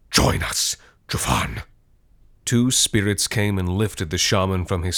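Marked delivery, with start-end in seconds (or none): 0.1-1.6 eerie whispered chant; echoing ethereal energy being. A narrator's reading. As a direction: whisper dropping very low. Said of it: "chanted the crowd".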